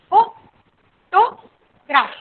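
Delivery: Photograph very slowly as fo... to... graf; long pauses inside photograph; syllable by syllable. Shown as a demonstration. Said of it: "तीन syllable". (Photograph बहुत धीरे, तीन टुकड़ों में)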